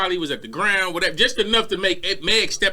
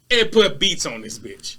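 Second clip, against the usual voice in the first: usual speaking voice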